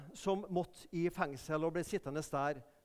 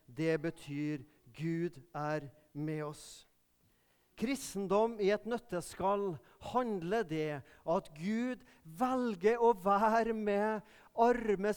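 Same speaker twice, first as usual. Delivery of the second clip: slow, weighty delivery of Gud er med oss; stressing the message; slower than usual. Real, not acted. Preacher driving home the Christmas message, slow and weighty. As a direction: lean on each word slowly. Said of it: "preacher stresses the key message".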